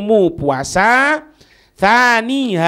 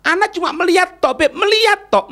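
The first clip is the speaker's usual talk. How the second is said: high emphatic exclamation; 10 semitones higher, faster than usual. Acted. Stressing that merely looking is enough.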